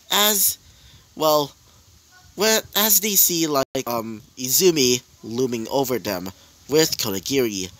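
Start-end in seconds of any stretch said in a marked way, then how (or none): none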